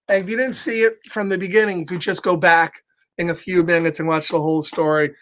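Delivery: usual speaking voice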